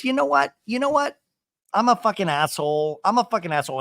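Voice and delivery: high, loud hammed-up indignation; exasperated mock ranting; mock outburst. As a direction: imitate someone blowing up before storming off. Acting out Shannon storming out.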